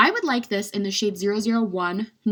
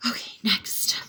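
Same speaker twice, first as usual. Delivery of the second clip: whispered. Real, not acted. Whispered move on.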